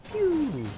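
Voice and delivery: squeaky laser pew; high pew sound effect. Pretend. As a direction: toy blaster zap.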